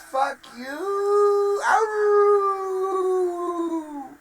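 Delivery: hammed-up crying complaint, voice breaking and cracking high; fake-cry wailing at Microshaft; mock sobbing.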